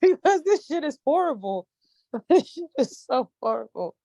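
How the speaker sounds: high, half-crying laugh; wheezing laughter. Real, not acted. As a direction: laugh till it hurts. Laughing hard about cough syrup.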